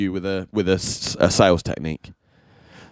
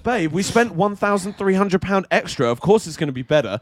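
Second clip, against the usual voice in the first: higher mocking imitation of his girlfriend; 9 semitones higher than usual. Teasing girlfriend about the pricey mattress, mock voice. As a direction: parrot her like a dupe. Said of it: "she's like".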